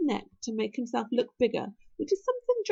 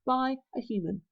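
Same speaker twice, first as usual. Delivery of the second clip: usual speaking voice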